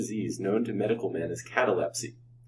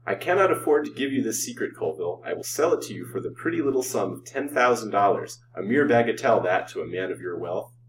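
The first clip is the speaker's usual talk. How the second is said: usual speaking voice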